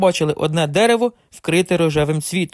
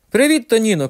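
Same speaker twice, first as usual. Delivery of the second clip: high call down from the tree branch; calling out cheerfully from up in the tree; grandpa shouting; 6 semitones higher than usual. A narrator's reading. Grandfather in tree shouts greeting to grandson, calling out. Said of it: "крикнув дідусь".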